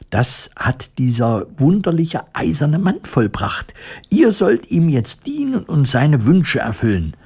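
high, dignified mouse queen. A narrator's reading. Mouse queen commanding her subjects, dignified. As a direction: give a royal order with dignity. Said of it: "sagte Ramina würdevoll".

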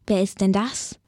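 plain reading voice

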